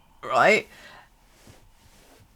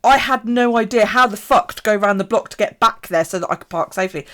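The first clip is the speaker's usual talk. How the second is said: ordinary talk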